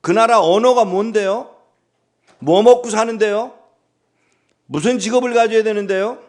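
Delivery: high, loud string of anxious questions; pestering questions. Acted. Abraham questioning God, high and loud. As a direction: fire off worried questions at God. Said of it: "라고 묻지 않아요".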